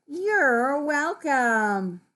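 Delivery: slow, drawn-out, lilting courtesy; reply kindly, stretching the words out; polite reply. Acted. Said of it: "That's polite, that's kindness".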